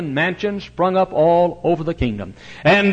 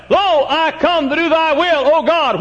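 ringing vow; 5 dB louder than usual. Acted. Quoting Christ's vow.

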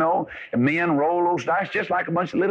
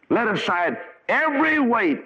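higher, ringing call to lay it down; urging the congregation to let go; preaching exhortation; 9 semitones higher than usual. Acted. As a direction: lift the voice on every weight.

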